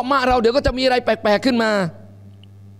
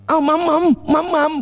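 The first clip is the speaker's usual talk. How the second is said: put-on baby feeding; 4 semitones higher than usual.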